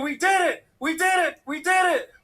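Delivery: loud, high celebratory shouting; jubilant stranger. Retold or imitated. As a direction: shout it over and over in triumph. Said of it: "a total stranger just hugged me".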